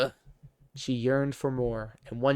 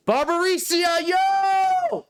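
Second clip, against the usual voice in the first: much higher, louder hype yell; hype shout; 6 dB louder, slower than usual. Real, not acted. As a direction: shout the name like an arena announcer. Hyping the fiend's name.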